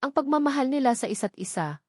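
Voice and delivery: plain reading voice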